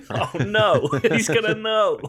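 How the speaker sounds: high laughing panic. Real, not acted. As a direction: squeal it through laughter. Laughing while speaking.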